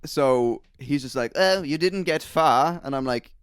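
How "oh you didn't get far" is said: high, loud plummy greeting; mock upper-class butler; posh butler. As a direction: gush to the dog, sniff at the walker.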